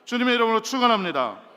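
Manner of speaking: booming, high preaching cry; ringing pulpit blessing; full-force blessing. Real, not acted. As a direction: proclaim it to the whole hall.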